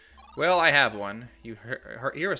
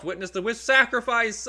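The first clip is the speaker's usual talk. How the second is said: much higher and louder, proclaiming; dramatic announcement; 11 semitones higher, 10 dB louder than usual. Acted. Player reading the game's big moment, loud dramatic proclamation. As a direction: boom it out like an epic reveal.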